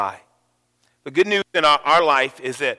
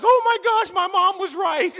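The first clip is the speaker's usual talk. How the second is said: high alarmed squeal of realization; comic high-pitched terror; mock panic. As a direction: play it as sudden comic fright, much higher. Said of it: "I was like".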